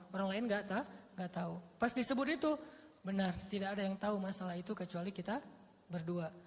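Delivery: usual speaking voice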